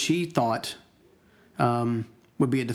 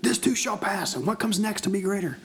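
raised, high-pitched declaration; calling it out higher, without a full shout; imitated louder repeat; 7 semitones higher than usual. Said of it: "say it louder".

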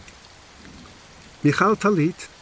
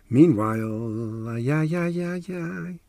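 mock lament; much slower than usual. Dramatic exclamation in the story.

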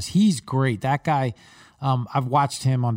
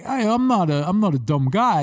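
Rocky; 4 semitones higher than usual. Acted. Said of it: "I felt like Rocky in Rocky Two".

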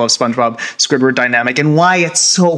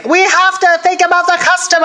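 high, eager cartoon sponge; SpongeBob voice. SpongeBob insisting to Squidward, high eager voice. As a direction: pitch it way up like SpongeBob. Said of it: "is like".

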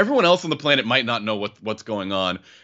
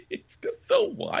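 laughing; 5 dB quieter than usual. Real, not acted. Laughing at the oversized truck.

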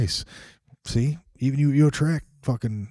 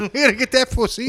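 high put-on Cockney; mock Cockney accent; 11 semitones higher than usual. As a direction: echo the repo man in Cockney. Echoing the mock accent bit.

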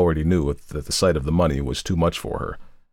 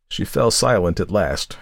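plain reading voice

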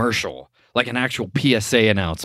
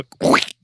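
squelching mouth noise; imitated sound effect. Friend imitating the ad's added effect, squelch noise. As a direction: make a squish sound with the mouth. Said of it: "they added a sound effects of".

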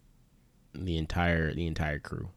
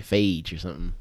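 normal talking voice

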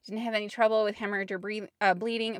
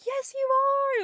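much higher, bright cheering reply; cheerleading; slower than usual. Retold or imitated. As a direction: cheer her on like a squad of supporters. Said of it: "It was like a cheerleading squad".

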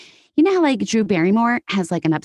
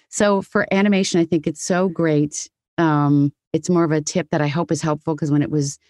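ordinary talk, nothing marked

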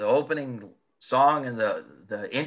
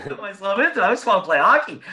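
laughing quote; 6 semitones higher, 5 dB louder, faster than usual. Retold or imitated. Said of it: "I'm like".

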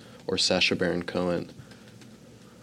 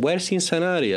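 put-on high comic Belgian-Cuban accent; 10 semitones higher than usual. Father asking where the city is, Belgian-Cuban accent. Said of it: "unidentifiable Belgian-Cuban person".

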